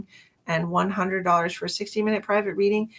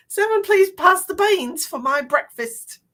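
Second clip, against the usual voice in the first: high, slow, loud mock-British; exaggerated British breakfast-table voice, drawn out; British accent; 9 semitones higher, 6 dB louder than usual. Host showing off British accents, high mock-British. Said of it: "There's all kinds of British accents".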